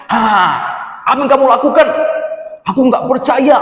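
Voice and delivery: high, loud shocked gasp of disbelief. Acted. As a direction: catch the thief red-handed, stunned. Papai catches Tutu stealing. (kaget dan tidak percaya)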